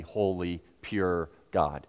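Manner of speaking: ordinary delivery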